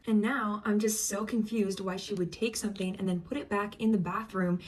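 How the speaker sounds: ordinary talk, nothing marked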